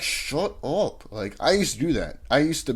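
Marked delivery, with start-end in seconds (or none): 0.0-1.1 slow, higher, stretched shut up; drawled shut up. Retold or imitated. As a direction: drag out the dismissal. Annoyed at interrupting joker.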